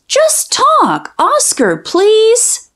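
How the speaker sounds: put-on a childish high plea; high pleading. Child begging her dog to talk, high pleading.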